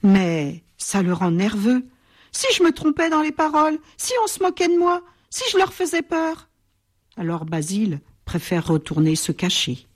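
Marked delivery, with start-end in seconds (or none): 2.3-6.5 high, fast anxious thoughts; nervous worrying. Acted. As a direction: rush the worries out nervously. Shy crocodile's worries.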